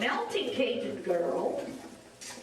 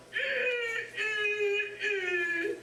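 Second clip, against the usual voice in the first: closed-mouth hum; 7 semitones higher, much slower than usual. Shown as a demonstration. Contrast with open ha.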